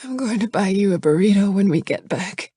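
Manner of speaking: plain reading voice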